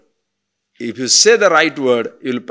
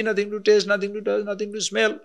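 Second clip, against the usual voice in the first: ordinary talk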